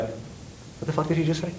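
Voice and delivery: hushed, high-pitched incredulous question; retold shock, small high voice; stunned disbelief. Retells his stunned reaction to insult.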